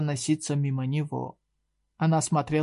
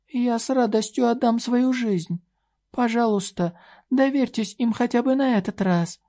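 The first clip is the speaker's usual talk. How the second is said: high, earnest fairy voice; a tiny fairy pleading, voiced much higher; pixie; 8 semitones higher, 3 dB louder than usual. A narrator's reading. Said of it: "голос розовой феи".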